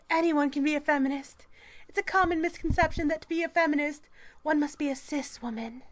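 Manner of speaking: high, loud mock-activist reading voice; strident article read. Reading a feminist article aloud to mock it, strident.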